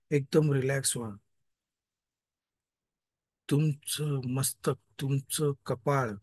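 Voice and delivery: guided meditation voice. Acted. Leads a meditation.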